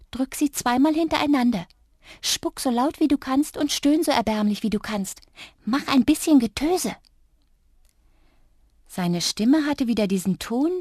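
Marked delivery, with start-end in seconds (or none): 0.1-7.0 high, eager whispered orders; whispered commands. A narrator's reading. Little Drups whispers orders to the girl, high and eager. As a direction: urge her on, higher, ending in a whisper. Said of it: "was er mir zuflüsterte".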